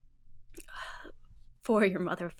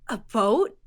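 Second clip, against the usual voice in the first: confused; 2 semitones higher, 9 dB louder than usual. Acted. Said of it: "Bee looks really confused".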